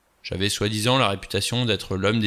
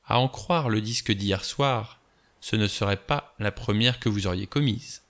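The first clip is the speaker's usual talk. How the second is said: words detached. Acted. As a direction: space out every word deliberately. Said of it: "en détachant ses mots".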